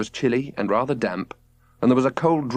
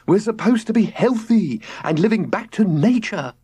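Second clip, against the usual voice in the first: complaining; 9 semitones higher than usual. A narrator's reading. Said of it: "he complained".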